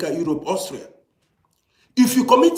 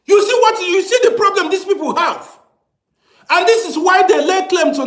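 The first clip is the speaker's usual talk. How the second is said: outraged rant; 11 semitones higher, 4 dB louder than usual. Real, not acted. Escalating rant at viewers.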